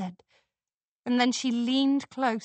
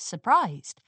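ordinary delivery, nothing marked

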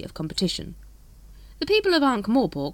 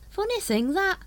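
thoughtful. A narrator's reading. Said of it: "said Brother Watchtower thoughtfully".

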